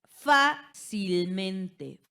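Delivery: "Fácilmente" muy lento, con FÁ fuerte y larga; énfasis en la sílaba tónica. Shown as a demonstration. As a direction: golpea el FÁ de "Fácilmente" y separa el resto. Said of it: "antepenúltima y ante". ("Fácilmente" silabeado: FÁ-cil-mente)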